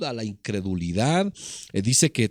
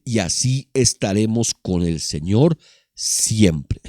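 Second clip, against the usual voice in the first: reading the verse out loud, with weight; booming preacher reading scripture; 3 semitones lower, 6 dB louder than usual. Acted. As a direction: proclaim the verse to the church.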